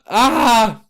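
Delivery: sharp cry of pain. Acted. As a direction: cry out as the blade cuts. Scalpel slicing chest.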